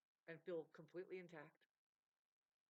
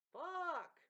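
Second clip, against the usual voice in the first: an angry shout, much higher and louder; 11 semitones higher, 12 dB louder than usual. Real, not acted. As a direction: blurt it out as a furious shout. Outburst mid-rant.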